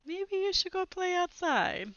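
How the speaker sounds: a mom shooing her little son outside, high and sweet. Acted. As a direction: sweetly nudge the kid out the door. Mom voiced in story.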